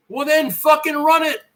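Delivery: normal talking voice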